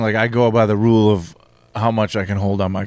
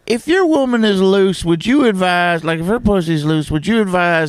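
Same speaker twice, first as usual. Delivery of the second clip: higher-pitched fake Southern caller voice, hammed up; southern accent; 5 semitones higher than usual. Caller phoning the radio host in a mock Southern accent. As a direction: put on a silly Southern accent for a prank caller. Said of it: "I don't know why I have a southern accent".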